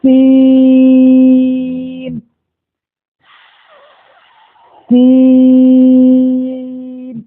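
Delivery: سین with a long hissing س, held like a whistle; drawn-out seen. Shown as a demonstration. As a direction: stretch سین slowly, hiss the س. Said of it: "تو ادا کریے". (سین کو کھینچ کر سیٹی کی طرح)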